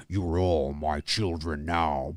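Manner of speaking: low, slow growl of a voice; deep Freddy voice. Shown as a demonstration. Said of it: "I can go like this".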